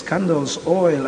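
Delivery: ordinary talk, nothing marked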